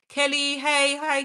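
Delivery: ordinary talk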